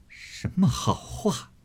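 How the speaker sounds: slow, tearful scornful question; crying scorn. Acted. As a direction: choke out the scorn through tears. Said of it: "一面说，一面哭". (带着哭腔冷笑反问)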